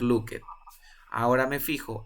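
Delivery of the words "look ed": "looked" as look-et, two syllables; wrong extra syllable. Shown as a demonstration. Teacher ruling out the mistake, "looked" as look-et. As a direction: give "looked" a separate ED syllable. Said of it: "No puede ser looked".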